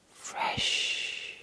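normal talking voice

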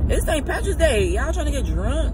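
high, loud, excited call-out; rally everyone to go drinking; party hype. Real, not acted. Hyping viewers up for a party.

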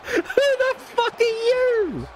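high, slow, stunned question; shocked disbelief. Real, not acted. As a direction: gasp it out in laughing shock. An unexpected wrestler appears.

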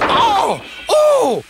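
very high, loud "oh" yelps; shrieked yelps of a struck character; pained cry. A narrator's reading. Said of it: "cried the van".

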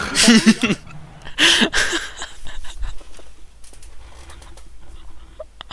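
gros fou rire aigu; éclat de rire. Real, not acted. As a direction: rire aux éclats, sans retenue. Rire fou après une insulte. (fou rire)

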